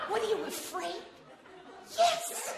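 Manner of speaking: wife teases husband about being scared; teasing. Acted. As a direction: needle him, half laughing. Teasing then admitting fear.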